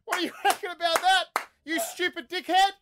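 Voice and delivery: very high, laughing mock outrage; laughing them out. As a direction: squeal the insults through scornful laughter. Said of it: "literally laughed them out of the room".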